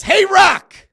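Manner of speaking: shouted, high, slowing to a taunting laugh; loud defiant taunt to a rival, slowing as it lands. Retold or imitated. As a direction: yell it right in his face, then savour the insult. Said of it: "And then Cody says".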